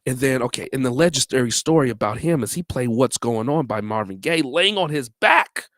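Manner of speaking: ordinary talk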